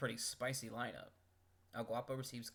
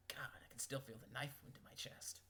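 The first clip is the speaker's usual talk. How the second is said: whisper; 6 dB quieter than usual. Acted. Mock pain whisper.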